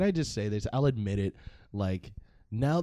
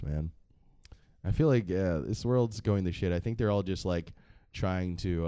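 ordinary talk, nothing marked

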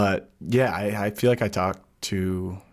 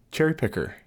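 lightly voiced toddler, higher and falling; imitating small son; 9 semitones higher than usual. Dad retelling how his boy spotted a cherry picker, lightly imitated. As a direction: pipe it up a little, like a small boy. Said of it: "and he goes".

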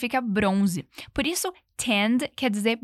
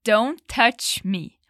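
Don't touch me said very slowly and clearly; slow model line; much slower than usual. Shown as a demonstration. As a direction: lay out each word slowly. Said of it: "não me toque".

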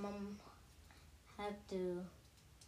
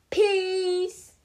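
loud sign-off; 9 semitones higher, 12 dB louder than usual. Acted.

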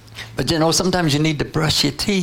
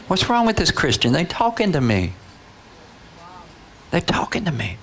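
higher-pitched, baffled wondering; acted amazement; 6 semitones higher than usual. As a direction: marvel at being spoken to.

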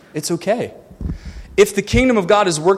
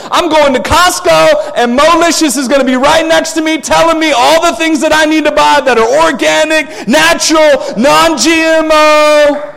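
building comic rant; 10 semitones higher, 7 dB louder than usual. Acted.

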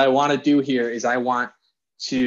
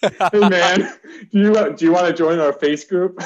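teasing; 4 semitones higher, 5 dB louder than usual. Pretend. Teasing the slip "face group.

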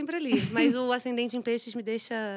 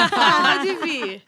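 louder, laughing through it; 5 semitones higher, 9 dB louder than usual. Real, not acted. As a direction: burst out laughing as you say it. Repeated line, now laughing loudly.

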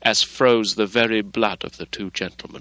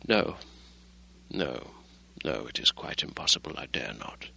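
softer and lower with each no, dying away; fading fear; 7 semitones lower, 9 dB quieter than usual. Acted. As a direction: shrink back in dread, trailing off. Doctor refuses in growing dread.

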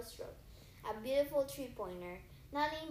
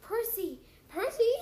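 high, loud startled call of "Percy"; rising in shock on the second call; gasped cry; 10 semitones higher, 9 dB louder than usual. Acted. Grover amazed at Percy's win, loud gasping calls. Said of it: "Grover gasped".

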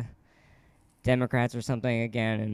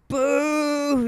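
high mock boo shout; heckler's loud boo; 11 semitones higher than usual. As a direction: boo him off the stage. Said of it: "doing this real loud".

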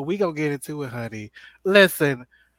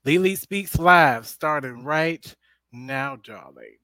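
show announcer; 2 semitones lower, 5 dB louder, slower than usual. Acted. Show intro sign-off.

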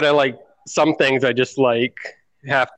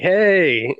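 higher, drawn-out calling out; half-voiced "hey" yelled from afar; mock yell; 6 semitones higher than usual. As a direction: imitate a lady hailing someone across a parking lot. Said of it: "some lady yells out from across the parking lot".

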